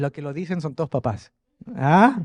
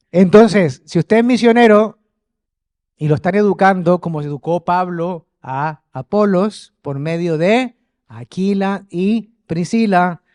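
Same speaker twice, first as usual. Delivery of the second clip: fervent preaching; 2 semitones higher, 8 dB louder, slower than usual. Real, not acted. Preaching climax to congregation.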